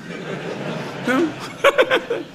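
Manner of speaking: soft unvoiced laugh at his own joke; breathy laugh. Real, not acted. The storyteller laughs at the runaway victim, a soft airy laugh. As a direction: let a quiet airy laugh slip out.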